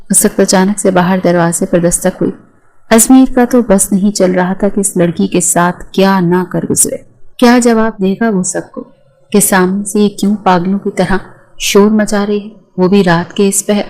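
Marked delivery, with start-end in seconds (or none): none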